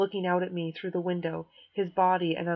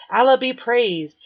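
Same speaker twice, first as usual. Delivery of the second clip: slow, loud, higher mock chant; mock fanatic; 5 semitones higher, 11 dB louder than usual. Kid with shirt on head mocks, slow and loud. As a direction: ham it up like a fake zealot. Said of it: "yammers a lot of gibberish".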